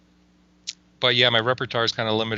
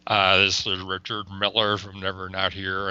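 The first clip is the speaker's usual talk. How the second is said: slow low rambling guru voice; slow and drawn out, lightly voiced guru; Richard Miller voice; 5 semitones lower than usual. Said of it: "I realized I can do Richard Miller's voice".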